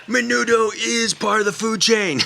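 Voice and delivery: high, loud, drawn-out declaration; hammed-up slow shout; mock-indignant proclamation. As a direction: proclaim it slowly at a comic high pitch. Comic retort to his editor.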